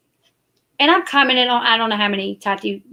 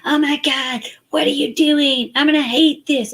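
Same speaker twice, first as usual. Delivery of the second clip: higher mock-irritated mom snapping at kids; mock snappy mom; 5 semitones higher than usual. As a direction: ham up an annoyed parent. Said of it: "I've never told my kids".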